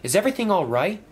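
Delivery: concerned friend noticing changes; lightly voiced friendly engine.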